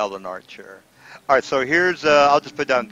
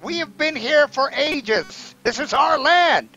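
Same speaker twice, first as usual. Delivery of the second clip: kobold leader; 13 semitones higher than usual. Acted. Kobold claiming the cavern, high and insistent. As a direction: stake the claim in a high creature voice. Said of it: "he says".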